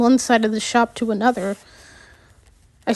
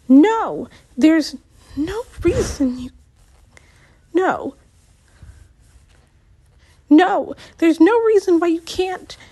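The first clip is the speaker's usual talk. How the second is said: raised agitated voice; 6 semitones higher than usual. Acted. Shapri's argument voiced.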